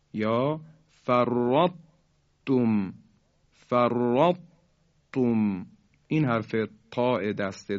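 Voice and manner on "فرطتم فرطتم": فرطتم very slow, heavy ط held before "tum"; incomplete merging. Shown as a demonstration. Tajwid teacher models a further example, فرطتم slow with heavy ط. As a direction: draw out فرطتم, stopping on a heavy ط. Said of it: "اینطور تلفظ کنیم".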